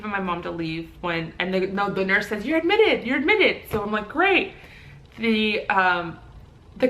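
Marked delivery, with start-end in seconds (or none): 2.4-3.6 high, sing-out nurse announcement; emphatic nurse. Retold or imitated. Nurse admitting woman in labour, emphatic announcement. 4.1-4.5 mock delight. Retold reaction to being admitted.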